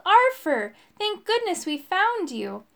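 high, loud call easing into relief; excited, relieved hail. A narrator's reading. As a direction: call out, relieved to find a friend. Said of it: "they called".

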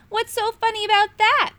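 high, loud, huffy little dragon; a tiny dragon taking offence; small dragon. A narrator's reading.

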